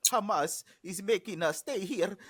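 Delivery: mocking a nurse with a bad Arabic accent. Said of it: "horrible Arabic accent".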